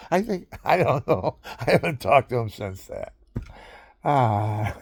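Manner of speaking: high, laughing voice; laughing while talking. Real, not acted. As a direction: talk through your own laughter. Breaks up laughing at own story.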